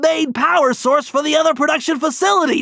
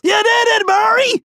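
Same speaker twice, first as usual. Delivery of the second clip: excited cheer; 8 semitones higher than usual. Acted. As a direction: cheer him on, thrilled. Cheering teammate's victory.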